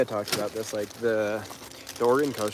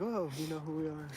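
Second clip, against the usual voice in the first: mock surprise; 7 semitones higher, 4 dB quieter than usual. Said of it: "And I'm like".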